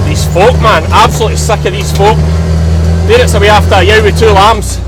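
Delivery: fuming. Real, not acted. Farmer ranting to camera about dog walkers, fuming. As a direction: spit it out in disgust.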